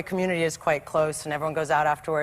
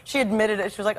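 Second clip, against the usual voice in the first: ordinary delivery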